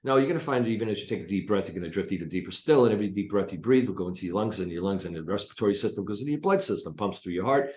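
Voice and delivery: fast, nonstop hypnotic induction patter; machine gun hypnosis patter. Shown as a demonstration. Hypnotist showing how he talked while pacing the room, fast patter. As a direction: rattle off the induction without a breath. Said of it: "I'd be talking like this".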